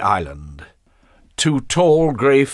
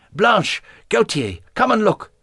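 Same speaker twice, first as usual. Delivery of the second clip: calling out; 13 semitones higher than usual. A narrator's reading. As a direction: call them over from the window. Marguerite calls to the others.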